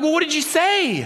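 high-pitched mock alarm; high, urgent imitated question; retold freak-out. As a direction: act out freaking out at the news. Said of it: "I kind of freaked out".

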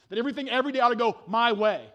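ordinary talk

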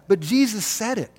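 ordinary talk

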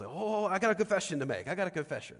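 mild mock yell of a confession; retold yell. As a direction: call out after her to stop her leaving.

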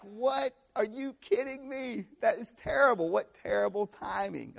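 high-pitched incredulous indignation; hammed-up "are you kidding me" fury; mock outrage. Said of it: "You are mad. You're like".

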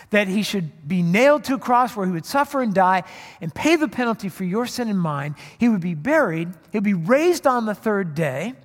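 full-force sermon delivery; preaching. Acted. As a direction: proclaim the gospel with rising fervour. Preaching the gospel message.

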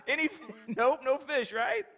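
playful jab about empty nets; laughing mock tease. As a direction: tease them with a chuckle. Jesus teasing the disciples.

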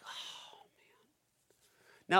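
just-woken sigh, whispered; sleepy whisper. Acted. Jesus woken by the disciples, groggy whisper. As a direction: breathe it out like someone woken from sleep.

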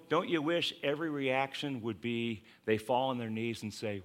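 ordinary talk

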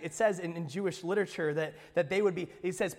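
usual speaking voice

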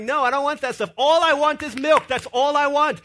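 father voicing his baby refusing food; baby's voice. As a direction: demand milk like a stubborn infant. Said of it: "She's like".

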